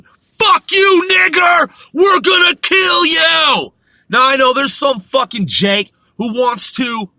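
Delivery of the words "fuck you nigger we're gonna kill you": high, loud mock-screamed slur; hateful yelled threat. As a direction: bellow it like a bigot threatening you. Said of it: "people could say whatever to you".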